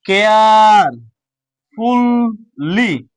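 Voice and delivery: কেয়ারফুলি hugely stretched, care... ful... ly; very slow sounded-out কেয়ারফুলি; slowly, bit by bit. Shown as a demonstration. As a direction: hold the first syllable of কেয়ারফুলি long, then ful, ly. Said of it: "আস্তে আস্তে".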